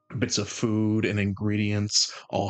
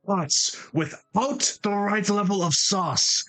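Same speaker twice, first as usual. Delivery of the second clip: authoritative chef voice; 12 semitones higher than usual. Acted. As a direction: bark it out like a head chef on crunch time. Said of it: "a authoritative voice say".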